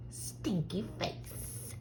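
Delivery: whispered, slow Stinky Face; hushed whisper. Acted. Child whispers the name to mama. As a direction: breathe the name softly.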